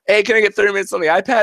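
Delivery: put-on high, fast asking kid; eager daughter. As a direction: rush the request like a hopeful kid. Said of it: "And my daughter goes".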